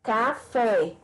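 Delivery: "café" with a firm final FÉ; stress on the accented syllable. Shown as a demonstration. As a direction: lean on the last syllable FÉ in "café". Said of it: "para marcar a sílaba tônica".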